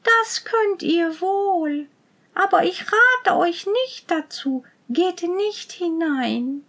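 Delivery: put-on high, slow, mournful girl; sad voice. A narrator's reading. Girl warning the prince not to enter, sadly. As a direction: warn them off sorrowfully, drawn out and high. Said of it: "sagte das Mädchen mit trauriger Stimme".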